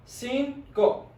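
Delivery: cinco drawn out in two parts; cinco broken syllable by syllable; slow syllables. Shown as a demonstration. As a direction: say cinco slowly, "cin", pause, "co". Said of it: "Say it slowly".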